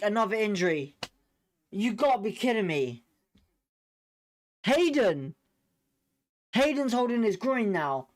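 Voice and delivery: ordinary talk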